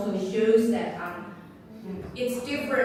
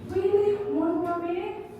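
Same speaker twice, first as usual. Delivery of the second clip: high-pitched displeased questioning; unhappy; 6 semitones higher than usual. Shown as a demonstration. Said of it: "I'm not happy".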